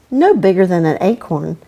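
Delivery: ordinary delivery, nothing marked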